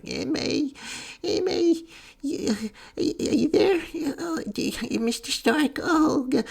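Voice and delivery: very high, whimpering mock-boy falsetto; broken, stammering falsetto imitation; Pete. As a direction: blubber helplessly in a squeaky put-on voice. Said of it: "while Pete's talking, he's like".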